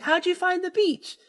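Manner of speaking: high-pitched girlish mimicry; imitating flustered daughter. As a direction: put on the girl's high voice to question dad. Voicing startled daughter questioning her dad.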